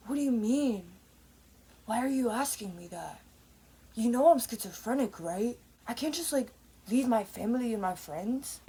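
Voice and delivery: loose, hammed-up character impression; Donnie Darko. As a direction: put on a rough imitation of a troubled film character. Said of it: "I hear Donnie Darko's voice".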